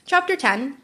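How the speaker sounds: plain reading voice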